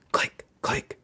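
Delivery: breathy whispered click, click; whispered click sounds. A narrator's reading. Narrator whispering the bear's claws, click click. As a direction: hush it like tiny claws ticking.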